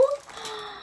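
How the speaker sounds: delighted gasp. Real, not acted.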